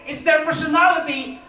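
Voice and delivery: ordinary talk, nothing marked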